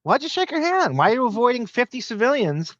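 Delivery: high, incredulous mock of Kimmel. Host mocking the handshake in a high Kimmel voice. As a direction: squeal it up high like a disgusted germaphobe. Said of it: "Kimmel should be saying".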